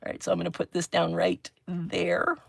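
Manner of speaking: normal talking voice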